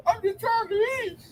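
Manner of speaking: high, strained imitation of a crying man pleading; mock crying plea. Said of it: "a video of the Edomite crying".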